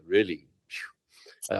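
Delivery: slow, unvoiced phew; doctor doubts the patient's Everest plan, whispered slowly. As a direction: breathe it out doubtfully, barely voiced.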